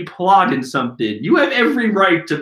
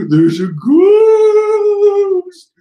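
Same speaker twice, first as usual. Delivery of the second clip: much higher, louder, drawn-out panicked shout; ninjas scream in fright; 8 dB louder, much slower than usual. Acted. The ninjas scream on seeing the ghost, high and slow. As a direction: scream it slowly, terrified. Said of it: "all the ninjas like".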